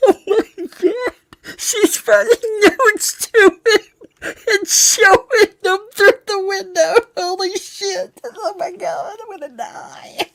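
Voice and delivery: high, helpless laughter through words; can't stop laughing; laughing hard. Real, not acted. Laughing at note-writing.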